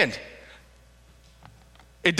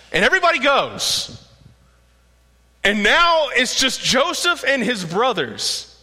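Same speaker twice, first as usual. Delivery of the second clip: ordinary talk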